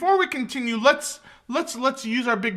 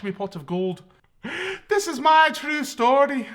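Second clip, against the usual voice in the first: put-on Scottish accent; 6 semitones higher than usual. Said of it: "that sounds like I'm talking from Brave".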